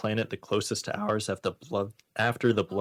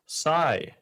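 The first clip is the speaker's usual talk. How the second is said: a loud, high spoken "Sigh"; sigh said aloud; 6 semitones higher, 5 dB louder than usual. Pretend. Host reading a line aloud, saying "Sigh" loudly. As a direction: say the word "Sigh" out loud as a mock sigh. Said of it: "she said aloud".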